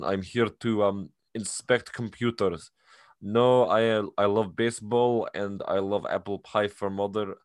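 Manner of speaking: hammed-up Russian accent; thick mock-Russian accent; full Russian accent. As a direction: imitate a Russian badly posing as American. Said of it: "he has the full Russian accent".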